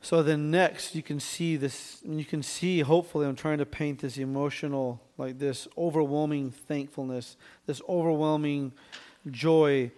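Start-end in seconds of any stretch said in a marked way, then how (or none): none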